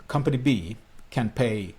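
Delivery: usual speaking voice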